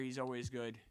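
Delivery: usual speaking voice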